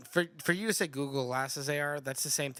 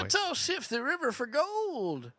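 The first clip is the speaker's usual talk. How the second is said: put-on high, slow old-prospector voice; old working man; 11 semitones higher, slower than usual. Old working man rallying others to pan gold, high slow drawl. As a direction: draw it out as a comic old-timer. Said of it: "like, he's a working man".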